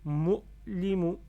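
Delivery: MO, LI, MOU loud, first vowel kept short; silent waw. Shown as a demonstration. Teacher shows unread waw to learners, short first syllable. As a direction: read MO short, then LI MOU firmly. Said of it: "je lis pas le waw qui est là".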